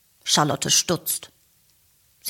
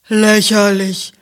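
scornful snort of a word; contemptuous; 4 dB louder than usual. A narrator's reading. Rosa scoffing at the cat, contemptuous. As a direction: sneer it like a disdainful cow. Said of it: "schnaubt verächtlich".

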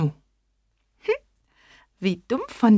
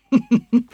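loud monster laugh; big laugh; 4 semitones higher, 6 dB louder than usual. Acted. Gryffalo laughs loudly at the mouse's boast. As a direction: burst out laughing. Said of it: "musste schrecklich lachen".

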